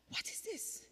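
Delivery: breath-light, high astonished whisper; soft, awestruck question; hushed amazement. Retold or imitated. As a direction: whisper it in disbelief. Retold amazement at standing painless.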